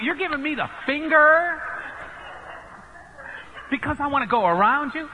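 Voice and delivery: hammed-up disbelief, rising on finger; incredulous outrage. Retells outraged shout at driver.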